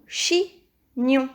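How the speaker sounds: chignon. split into two beats; measured chignon. with a gap mid-word; syllable by syllable. Shown as a demonstration. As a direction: say "chignon." as chi, pause, gnon. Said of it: "Il y a deux syllabes".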